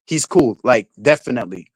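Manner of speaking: ordinary talk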